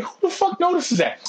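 high, exasperated disbelief; mocking girlfriend's fussiness; incredulous. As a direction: scoff it up high. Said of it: "I'm like".

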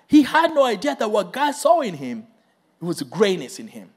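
usual speaking voice

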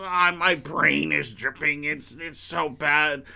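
slow drawn-out moaning; dramatic sick man. Retold or imitated. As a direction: whine about a runny nose. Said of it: "Willie gets sick".

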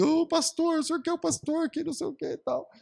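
high, fawning imitation of a sister addressing her pastor; imitating the sister. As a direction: mimic her gushing over the pastor, high.

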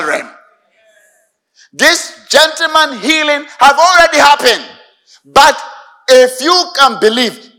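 loud, fervent sermon delivery, slowing to cry out key lines; full-force preaching. Acted. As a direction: preach it with rising fire to the congregation.

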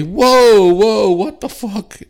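high, loud, drawn-out whoa; alarmed interruption. Retold or imitated. Son cutting into dad's rant, loudly taken aback. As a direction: shout over the ranting to halt it.

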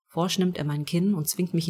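usual speaking voice

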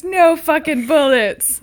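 a hint of a grumpy ghost; mock-grumpy complaint; angry grumbling. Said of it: "Angry grumbling".

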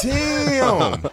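high, loud stunned exclamation; shocked damn. Retold or imitated. Retelling a friend's salvia trip, stunned high yelp. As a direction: act out the shock of seeing your friend trip. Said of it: "I was like".

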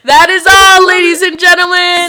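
loud, high announcer sign-off. Real, not acted. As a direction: announce it like a host. Signing off podcast.

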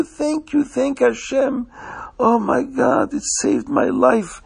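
high, thrilled and grateful; overjoyed to get his money back; excited man. Acted. Man thanks the finder for returning his money, excited and high. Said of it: "The man was so excited".

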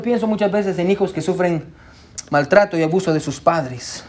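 usual speaking voice

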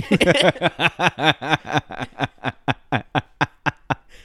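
helpless high laughing fit; long loud cackle. Real, not acted. Friend cracking up at the sketch, long loud laughter. Cracks up at bleeding close-ups.

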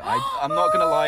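a high, loud embarrassed squeak; sheepish admission. Real, not acted. Admitting to friends he is a Disney fan, high squeal. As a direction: confess it with a sudden high squeal. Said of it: "Are you a Disney dude?".

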